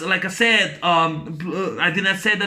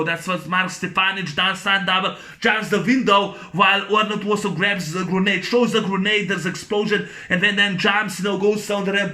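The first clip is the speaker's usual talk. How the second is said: usual speaking voice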